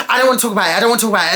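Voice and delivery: a hammed-up huffy refusal; stubborn refusal. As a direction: repeat it like a sulky child refusing. Imitating his refusal to talk.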